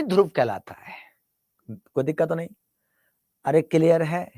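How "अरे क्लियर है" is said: normal talking voice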